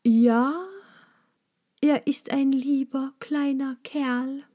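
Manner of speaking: lightly voiced tenderness for the bumblebee; tender. A narrator's reading. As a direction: croon over the prey. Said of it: "antwortete sie zärtlich".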